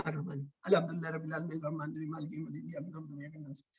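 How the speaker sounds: rattling the verses off fast, fading to a mumble; hurried mumbled recitation. Shown as a demonstration. Teacher mocking how most rush the prayer: hurried mumble. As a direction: race through it carelessly, dropping the voice. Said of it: "ایسے ہی پڑھتے ہیں نا".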